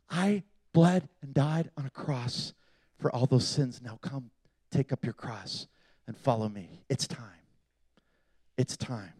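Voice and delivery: low, very soft voice of God calling; quiet, low plea, with pauses between phrases; hushed divine appeal. Acted. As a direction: speak softly and low, as God calling someone close. Acting God's tender call to follow.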